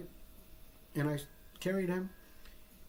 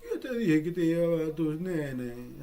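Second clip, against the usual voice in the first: louder, told-you-so jab in Spanish; loud teasing friend; 6 dB louder than usual. Retold or imitated. Imagined friend answering from beyond.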